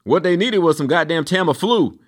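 normal talking voice

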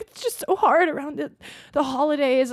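high-pitched tearful imitation; imitated bawling. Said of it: "she just started full on bawling".

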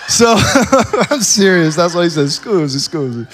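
loud, laughing through the words; real laughter. Real, not acted. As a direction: crack up while insisting on the story. Cracking up at own story.

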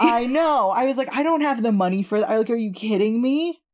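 high and loud indignation; outraged disbelief at the bill; indignant. Retold or imitated. Retelling outrage about the bill.

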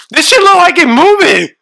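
high, loud, alarmed voice; high frightened cry; freaked-out squeal. Real, not acted. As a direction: squeal it like the bug just twitched. Freaking out over bugs.

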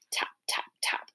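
hushed, voiceless taps; whispered tapping. A narrator's reading. Narrator imitating the owl tapping at the window, whispered. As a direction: whisper it like light knocks.

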